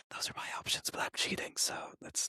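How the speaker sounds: sneaky unvoiced whisper. Real, not acted. Guest whispers a confession about cheating on his answers. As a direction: whisper it like a guilty secret.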